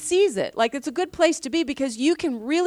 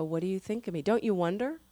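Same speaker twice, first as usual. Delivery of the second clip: low, soft question to God; hushed, lowered voice in prayer; quiet prayer; 4 semitones lower, 7 dB quieter, faster than usual. Acted. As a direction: ask it softly and privately, as in prayer. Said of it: "Just get quiet with the Lord".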